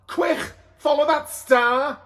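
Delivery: high, loud and slow; rally everyone; urgent call. A narrator's reading. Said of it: "says Donkeyman".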